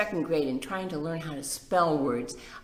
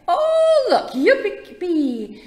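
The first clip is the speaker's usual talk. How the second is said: high, loud delighted squeal; excited yippee; 12 dB louder, slower than usual. Acted. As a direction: shout for joy at the hole. Young woodpecker delighted at hole.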